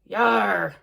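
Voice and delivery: low pirate yar; pirate growl. Acted. Pirate pig voice.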